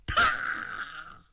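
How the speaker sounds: sharp high slap of a spear striking; a storyteller's sharp clack; sound effect. Acted. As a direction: crack it out like metal on metal. Spear knocks hammer away.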